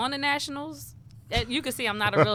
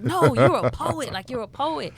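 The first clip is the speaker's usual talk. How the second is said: slow, louder insistence on NO; insistent fans; 5 semitones higher, 8 dB louder than usual. Retold or imitated. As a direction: press the point like an insistent fan. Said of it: "they were like".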